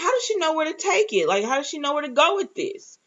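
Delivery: puzzled excitement. Retold or imitated. Viewer wondering about the key, high puzzled question. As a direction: ask it bright and high, baffled. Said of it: "I was like".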